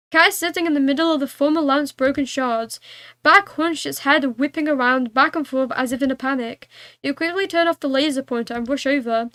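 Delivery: ordinary delivery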